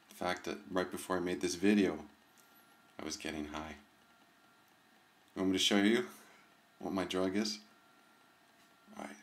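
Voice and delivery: hushed, conspiratorial and soft; secretive. Acted. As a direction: lean in like sharing a naughty secret. Teasing secret to viewers.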